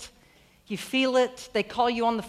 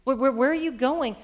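mildly raised, incredulous appeal; crying out; 3 semitones higher, 4 dB louder than usual. Acted. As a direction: call after someone walking away. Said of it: "host of heaven kind of cries".

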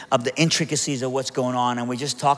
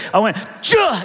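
a louder yelp of 'Cha!' cut short; retold yelp; 7 semitones higher, 6 dB louder than usual. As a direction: blurt 'Cha!' as the airbag hits. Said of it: "He goes".

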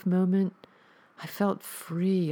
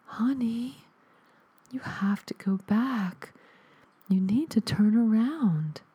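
high, breathless ghost whisper from the static; ghostly whisper; 6 semitones higher than usual. Acted. Dead mother's ghost whispers to her son through static, high and airless. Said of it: "a ghostly voice pushed the static away".

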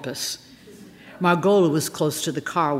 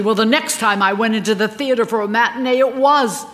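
higher, lightly voiced star recounting; Margola telling her tale; 7 semitones higher, 5 dB louder than usual. A narrator's reading. Star confiding her story to a friend in the car, lightly higher voice. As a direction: tell it as the aggrieved leading lady.

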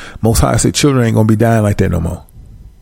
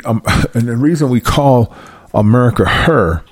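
ordinary talk, nothing marked

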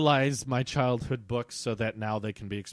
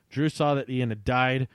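usual speaking voice

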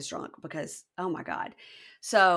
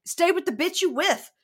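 higher, louder angry yelling; a mock yell at his ex; 7 semitones higher, 5 dB louder than usual. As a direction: yell it at her. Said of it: "Deontay starts yelling at her".

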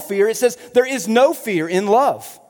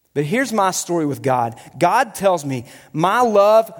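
usual speaking voice

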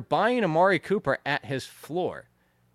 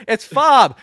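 higher, louder exasperated insistence; irritated correction punched out loud; annoyed; 6 semitones higher, 9 dB louder than usual. Real, not acted. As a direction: set them straight, emphatically. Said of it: "I just get annoyed by".

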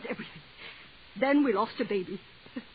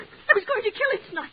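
blurted confession; 11 semitones higher than usual. Acted. Woman confessing to the clerk, blurted out high. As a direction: blurt out the terrible truth.

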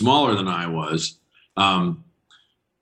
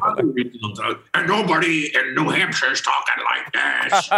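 high, nasal-free goofy Sandler impression; mock Adam Sandler voice; 6 semitones higher than usual. Guest answering in Sandler's voice, goofy high impression. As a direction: do Sandler as a joke. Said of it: "You're just doing Adam Sandler".

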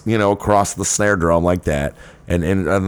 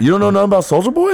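much higher, fast incredulous cry; mock disbelief; 12 semitones higher, 3 dB louder, faster than usual. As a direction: shriek it in fake shock.

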